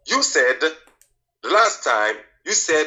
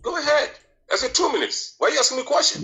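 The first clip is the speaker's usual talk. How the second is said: pitched high, protesting it's unfair; exasperated protest; 8 semitones higher, faster than usual. Real, not acted. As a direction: push back in a high exasperated voice. Exasperated debater objects to question.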